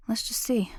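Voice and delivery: breathy whisper to herself; a quick whispered aside. Real, not acted. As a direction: mutter it under your breath while searching. Muttering to herself while searching.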